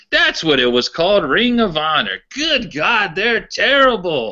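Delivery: put-on high-pitched Pittsburgh old-timer accent, disgusted; mock the old fan's Pittsburgh accent, high and scornful; Pittsburgh accent impression. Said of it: "I'll try to do his accent".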